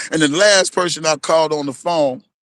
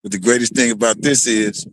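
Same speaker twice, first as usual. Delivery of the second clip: ordinary talk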